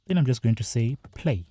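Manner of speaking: ordinary talk, nothing marked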